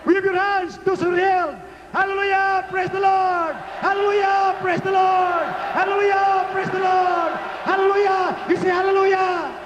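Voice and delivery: high, ringing repeated shouts; rally the crowd with held-out cries; full-force praise preaching. Real, not acted. Preacher telling the healed to wave hands, high rallying shout.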